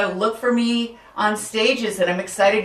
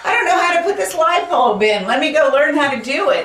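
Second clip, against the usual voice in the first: high, loud and hurried mock helplessness; exaggerated eager beginner, pitched way up; comic clueless searcher; 9 semitones higher, 6 dB louder, faster than usual. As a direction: play up being stumped, quick and loud. Acts out a clueless YouTube searcher.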